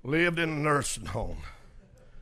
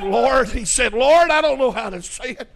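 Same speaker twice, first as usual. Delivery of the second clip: pleading prayer; 7 semitones higher, 6 dB louder than usual. Retold or imitated. Acting out man's prayer of repentance.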